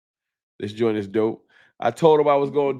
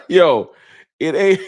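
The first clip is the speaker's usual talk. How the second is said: breaking into laughter, higher and louder; can't get the words out for laughing; 6 semitones higher, 8 dB louder, slower than usual. Real, not acted. Cracks up at first viewer.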